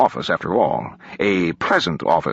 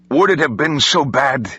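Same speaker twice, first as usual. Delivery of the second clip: higher-pitched cry at the sky; anguished shout, held back in volume; restrained shout; 6 semitones higher than usual. A narrator's reading. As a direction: call out in protest, not full force. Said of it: "he shouted at the sky".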